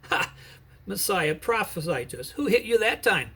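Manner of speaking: mild mocking jeer. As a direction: sneer at the blindfolded prisoner. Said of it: "They were making fun of him and mocking him".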